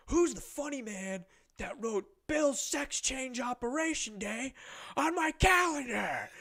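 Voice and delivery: high, slow, drawn-out mock bellow; drunk angry dad. Drunk dad confronting boys about his calendar, imitated anger. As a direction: build to a hammed-up enraged shout. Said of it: "he definitely had been drinking".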